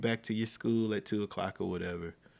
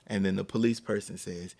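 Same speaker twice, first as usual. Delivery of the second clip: ordinary talk, nothing marked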